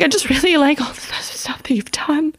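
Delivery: high, whispery voice on the verge of tears; tearful fangirl. Retold or imitated. Comic retells gushing to her idol, mock tearful. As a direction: squeak out praise while trying not to cry. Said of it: "I'm starting to cry".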